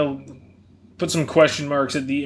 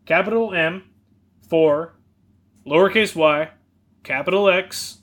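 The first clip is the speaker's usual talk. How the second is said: spelling it out. Shown as a demonstration. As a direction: spell each character out carefully. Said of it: "just in case lowercase and capitals matter".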